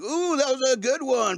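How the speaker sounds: high, bright-up delight at a fart; delighted ooh. Retold or imitated. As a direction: squeal it out like it's the best joke ever. Friends laughing at farts.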